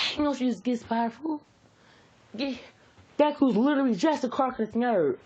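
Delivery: high whining brat; whiny excuse. Acted. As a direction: whine your excuse to mom. Bakugo whining excuse in story.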